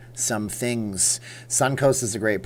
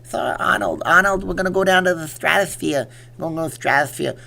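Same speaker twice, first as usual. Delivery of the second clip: slurred action-star impression, rising louder; hammy movie-boxer mimicry; Sylvester Stallone; 6 semitones higher, 5 dB louder than usual. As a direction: pitch a plan in a tough-guy voice. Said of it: "so, how did I do it?".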